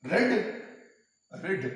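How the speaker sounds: रेड said with a short e; रेड, short e like bed; pronounced red. Shown as a demonstration. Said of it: "इसके pronunciation में". (रेड छोटे ए के साथ)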